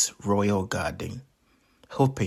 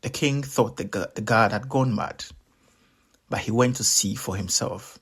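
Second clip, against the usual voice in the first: ordinary delivery, nothing marked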